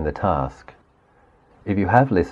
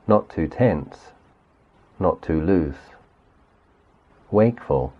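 guided meditation. Acted. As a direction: gently lead the listener through the body, unhurried. Leads guided body sweep meditation.

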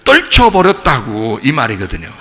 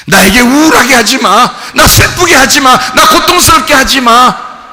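loud and higher, forbidding sorrow; shouting; 8 semitones higher, 6 dB louder than usual. Acted. Believer ordering gloom and pain to stop, shouting. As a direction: push each line out hard. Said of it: "이게 아포티나스".

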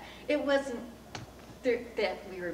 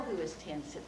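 plain reading voice

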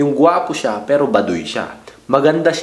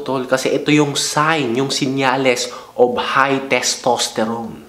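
usual speaking voice

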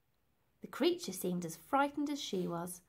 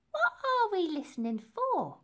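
restless lady; 10 semitones higher, 5 dB louder than usual. Acted. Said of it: "became restless and asked".

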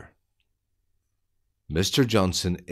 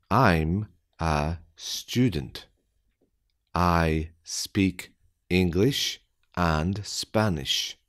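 slow, deliberate, word by word; careful slowed-down reading; slow dictation; slower than usual. Shown as a demonstration. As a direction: spell it out slowly for beginners. Slow repeat for learners.

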